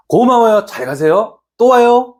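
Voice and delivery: lightly imitated friendly send-off, mostly high-pitched; high, sing-out goodbye of a shop owner; shopkeeper's farewell. Shop owner sees off a regular, lightly imitated friendly farewell. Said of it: "이러면서 딱 말씀을 해주신단".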